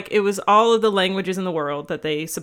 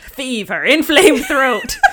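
slow loud pirate voice; pirate accent; 6 semitones higher, 7 dB louder, slower than usual. Acted. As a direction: ham up the pirate side effects. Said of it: "Wow, you're good at it".

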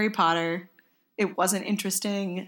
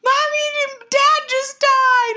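much higher, louder, slow whiny plea; distraught kid protest; 6 dB louder, slower than usual. Retold or imitated. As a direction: beg like a kid upset over a movie death. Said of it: "I'm like".

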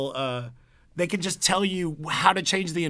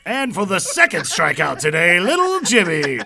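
hammed-up Little League announcing; baseball announcer; 3 semitones higher, 7 dB louder than usual. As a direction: stretch the name out over the PA.

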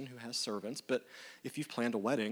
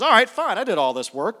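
indignant host; 9 semitones higher, 13 dB louder than usual. Acted. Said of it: "She goes".

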